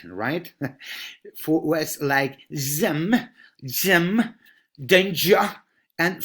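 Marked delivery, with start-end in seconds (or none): 1.4-3.1 zem with a buzzing, slowed Z; vibrating Z. Shown as a demonstration. Teacher slows down to show the buzzing Z. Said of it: "a vibrating Z". 4.8-5.5 danger, its J sound punched loud; stressed English J. Shown as a demonstration. Teacher drills a difficult sound, louder. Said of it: "Difficult one".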